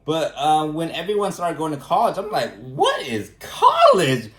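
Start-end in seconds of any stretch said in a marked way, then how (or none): 2.6-4.3 mock clueless. Mock clueless question about college.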